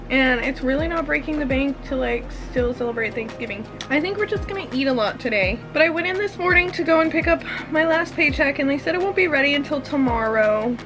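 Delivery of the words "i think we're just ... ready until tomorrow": normal talking voice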